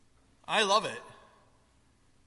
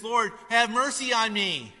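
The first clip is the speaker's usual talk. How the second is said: slow, raised plea for mercy; dramatic servant's plea, higher and louder; begging; 6 semitones higher, 7 dB louder than usual. A narrator's reading. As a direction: beg loudly, stretching the words. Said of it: "the servant begs".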